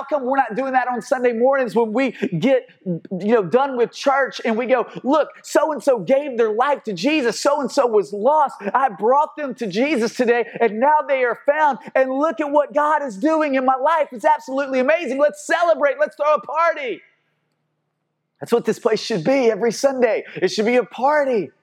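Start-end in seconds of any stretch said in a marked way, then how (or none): 5.0-17.0 excited celebration. Retold or imitated. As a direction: announce it like the best news. Imagined churchgoer celebrating conversion.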